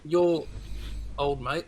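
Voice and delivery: ordinary talk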